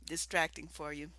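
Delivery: ordinary talk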